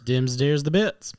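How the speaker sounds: high, loud jokey jingle; sing-song the sign-off. Pretend.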